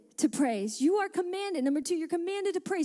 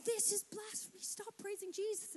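lightly voiced objector, soft and higher; a hushed, higher-pitched imitation of disapproval; mock pious objection; 3 semitones higher, 13 dB quieter than usual. Repeats the religious people's objection.

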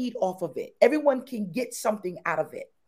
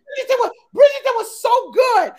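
high, loud, laughing gush; excited gushing; 11 semitones higher, 10 dB louder than usual. Real, not acted. Host gushing about Bridgerton to Melissa, excited and loud. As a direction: gush about the show like a fan.

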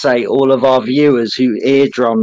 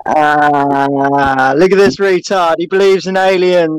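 higher, drawn-out jeering crowd voice; mock jeering; 5 semitones higher, 3 dB louder than usual. Co-host imitating sceptics ridiculing alien believers, mock jeering. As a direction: jeer like a playground taunt, stretching the "ah". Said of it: "they go".

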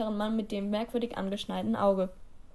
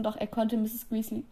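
usual speaking voice

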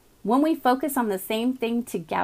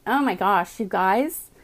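ordinary talk, nothing marked

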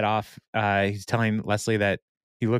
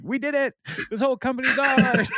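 mock triumphant outburst, pitched way up; freaking-out glee; 13 semitones higher than usual. Andy celebrating winning the company, high mock freak-out. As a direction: imitate an overjoyed winner in a high voice. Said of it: "Andy freaks out".

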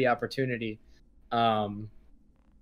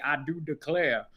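mock Southern accent; 3 dB quieter than usual. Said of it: "I was waiting for him to say".

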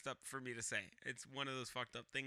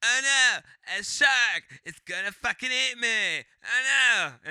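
high, loud cartoonish child in terror; hammed-up kid's cry of alarm; mock panicking kid; 7 semitones higher, 13 dB louder, slower than usual. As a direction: play a kid shouting at a shark, pitched up. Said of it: "and he's like".

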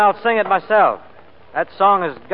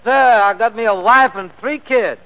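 higher, louder comic stage voice; minstrel stage patter; 7 semitones higher, 4 dB louder than usual. Acted. Sambo answering the interlocutor on stage, broad comic voice. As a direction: play it broad for the audience.

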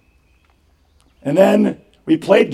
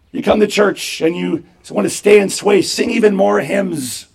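usual speaking voice

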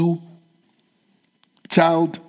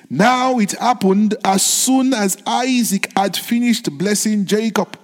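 solemn scripture reading; 4 semitones higher than usual. Acted.

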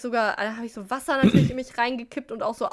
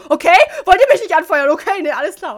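loud, high call to friends while running past; hammed-up shouted complaint; mock indignant shout; 9 semitones higher, 13 dB louder than usual. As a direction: yell it in playful outrage. Acts calling out to friends passing.

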